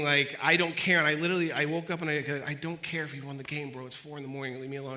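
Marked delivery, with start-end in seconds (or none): 2.5-4.9 low, quiet mock-groggy brush-off; sleepy grumble. Woken sleeper to roommate at four, sleepy grumble. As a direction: grumble softly as if half asleep.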